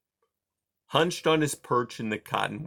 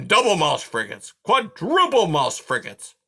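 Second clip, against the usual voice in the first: rising, louder porcupine curses climbing high; building curse; 6 semitones higher, 7 dB louder than usual. A narrator's reading. Aerith escalating his curses, pitch climbing high. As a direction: pile the curses up, higher each time. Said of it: "he muttered".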